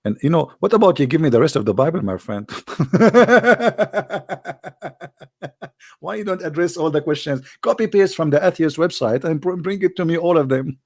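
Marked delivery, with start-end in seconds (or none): 2.5-5.1 mocking laugh. Real, not acted.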